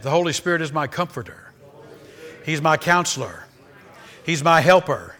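each line spaced and loud, "my" stressed; call to repeat. Shown as a demonstration. Pastor leads church in repeating, emphatic. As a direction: lead them line by line. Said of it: "say that".

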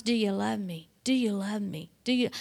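softly voiced repeated question. The Lord asking Peter three times, soft mock-dialogue questioning. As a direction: ask it softly, in a retold voice.

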